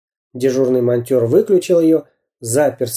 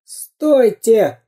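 high, falling imploring cry; desperate cartoon-villain wail; pleading; 10 semitones higher, 3 dB louder than usual. A narrator's reading. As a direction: beg them to stop. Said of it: "умолял он".

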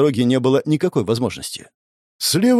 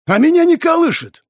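much higher, loud enraged yell; furious outburst; 5 dB louder than usual. A narrator's reading. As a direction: explode at him. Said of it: "Завёлся бугай".

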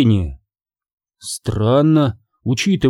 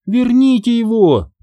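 high, pleading little fairy voice; high-pitched pitiful plea; plaintive; 9 semitones higher than usual. A narrator's reading. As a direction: beg piteously in a high fairy voice. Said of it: "жалобно попросила фея-мотылёк".

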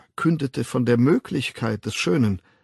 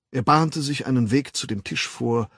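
usual speaking voice

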